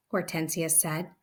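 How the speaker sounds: usual speaking voice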